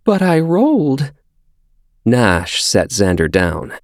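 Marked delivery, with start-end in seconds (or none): 0.0-1.1 put-on high child voice, near tears; a little boy trying not to cry; quivering. A narrator's reading. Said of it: "Xander's voice quivered".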